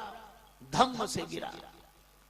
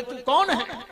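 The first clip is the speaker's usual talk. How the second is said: raised, higher-pitched demand; loud challenge; 6 semitones higher, 5 dB louder than usual. Retold or imitated. Angad confronts the fake Ravan, loud challenge. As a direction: challenge him sharply, pitch jumping up.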